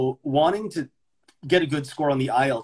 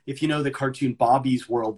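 ordinary talk

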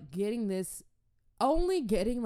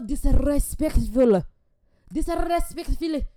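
louder, higher, outraged; heated rant; 5 semitones higher, 6 dB louder than usual. Real, not acted. Ranting to viewers about disrespectful people, loud and outraged. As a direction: build up indignant and loud.

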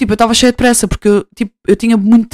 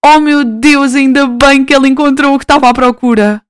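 louder, bright gushing relief; gushing joy; 4 semitones higher, 4 dB louder than usual. Retold or imitated. Vlogger recalls stranger's find, louder gushing joy. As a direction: gush with happiness for her. Retold own joy in shop.

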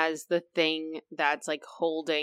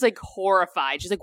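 ordinary delivery

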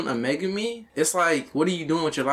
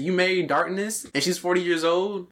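usual speaking voice

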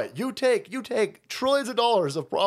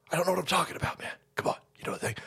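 whisper; 3 semitones lower, 7 dB quieter, much faster than usual. Retold or imitated. Biden mumble impression.

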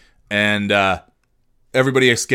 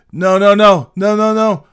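panicked pleading; 9 semitones higher, 8 dB louder than usual. Retold or imitated. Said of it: "this kid's like".

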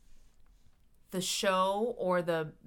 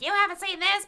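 mock listener; 14 semitones higher, 8 dB louder than usual. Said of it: "Y'all can be like".

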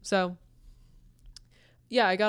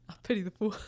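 a quick, light Mr. T quip; faster than usual. Pretend. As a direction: toss off a fast Mr. T catchphrase. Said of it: "we'll call him Mister T".

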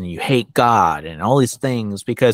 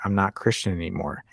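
usual speaking voice

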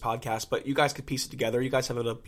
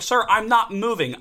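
retold protest; 8 semitones higher, 6 dB louder than usual. Retold protest to the yelling man.

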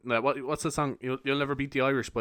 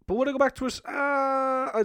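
mock dramatic wail; 12 semitones higher than usual.

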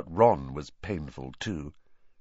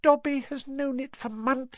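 high trembling elf voice; Dobby voice. Acted. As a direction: tremble with secret fear. Dobby continues.

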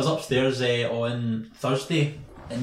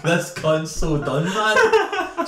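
slow words, breaking into high laughter on man; can barely get it out for laughing; real laugh bursting through the line; howling laughter; 5 semitones higher, 4 dB louder, much slower than usual. Real, not acted. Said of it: "I was howling after he left".

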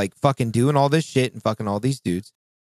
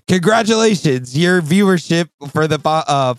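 mock announcement; 5 semitones higher, 4 dB louder than usual. Reading out a stats notification.

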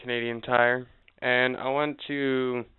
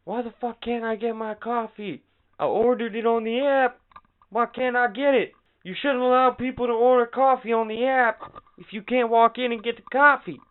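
much higher, louder indignant rant; imitating angry customers; 11 semitones higher, 4 dB louder than usual. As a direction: rant like an entitled customer at the drive-through. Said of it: "screaming at the window".

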